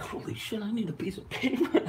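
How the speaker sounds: mock shock. Caller retelling a coffee run, in mock disbelief. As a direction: act stunned at the long order. Overwhelmed by friends' coffee orders.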